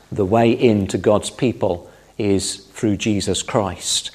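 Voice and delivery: normal talking voice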